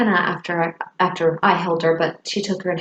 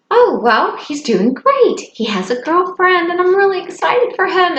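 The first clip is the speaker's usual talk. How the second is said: high, lively upbeat reply; telling happy news brightly; cheerful news; 11 semitones higher, 3 dB louder than usual. Retold or imitated. Kate answers about Johnny's girlfriend, cheerfully upbeat. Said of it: "And I thought".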